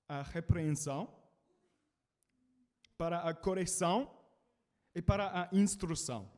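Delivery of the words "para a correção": ordinary delivery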